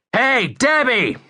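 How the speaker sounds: high, drawn-out holler; calling out. A narrator's reading. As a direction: holler to get her attention across the street. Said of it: "I hollered".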